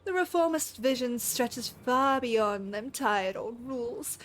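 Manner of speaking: put-on lightly done rough British pirate accent; a sneering pirate voice in a shaky British accent; amateur British accent reading a pirate villain; attempted British accent. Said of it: "I apologize in advance for the accent".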